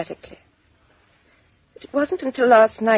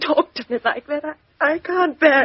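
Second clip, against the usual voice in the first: distressed plea; 6 semitones higher than usual. Acted. Woman breaking under detective's pressure.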